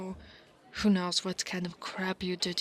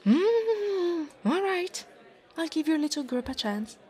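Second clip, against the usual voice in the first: grudging consent; 8 semitones higher, 4 dB louder than usual. Acted. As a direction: give in with a show of reluctance, pitched high. Gives in to the boy's group.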